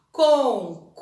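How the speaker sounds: "Concurso" in syllables, CUR loud and drawn out; stress on CUR. Shown as a demonstration. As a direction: punch the middle syllable of "Concurso". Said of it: "destaquem a sílaba pronunciada com mais intensidade".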